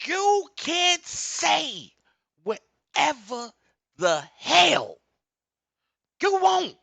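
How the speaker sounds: very high, slow, shouted words; booming shout. Real, not acted. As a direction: stretch it out at full volume. Repeating the line even louder.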